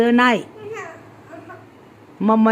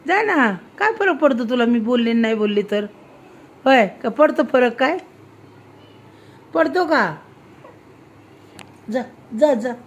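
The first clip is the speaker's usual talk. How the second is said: ordinary talk, nothing marked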